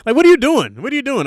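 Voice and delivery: high, loud disbelief at the villain; baffled hero. Acted. Hero shouting at a lazy villain, loudly baffled. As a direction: demand answers, bewildered and loud. Confronting the Mad Hatter-type villain.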